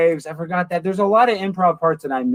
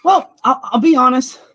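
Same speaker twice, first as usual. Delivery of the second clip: excited; 10 semitones higher, 6 dB louder than usual. Real, not acted. Excited reply.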